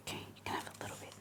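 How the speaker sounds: fast soft whisper. Real, not acted. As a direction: hush it quickly to the dog. Whispering to the dog.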